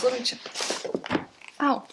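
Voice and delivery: ordinary talk, nothing marked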